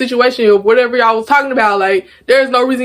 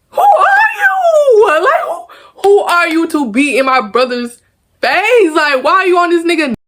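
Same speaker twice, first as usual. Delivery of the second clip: angry yelling; 8 semitones higher than usual. Retold or imitated. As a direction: tear into her like she crossed a line. Retold confronting a girl.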